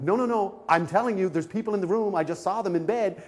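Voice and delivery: high-pitched, urgent protest; insist, getting worked up; agitated insistence. Retold or imitated. Guest tells disbelieving front desk about people in room, agitated.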